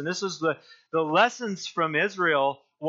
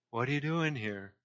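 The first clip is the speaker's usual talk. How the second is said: hushed, softer question from God; quiet voice of the Lord; 8 dB quieter than usual. Acted. The Lord questioning Elijah in the cave, hushed. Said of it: "the Lord said".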